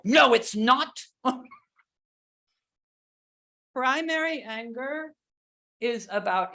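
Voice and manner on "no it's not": mock angry protest, louder; angry child. Acting out a child who denies being scared, loud mock-angry protest. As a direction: act a child loudly refusing. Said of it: "when I see them very angry".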